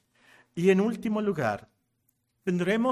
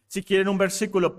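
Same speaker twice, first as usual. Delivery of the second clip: normal talking voice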